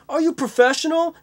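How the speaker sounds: high, mock ranting actor. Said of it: "when he's like".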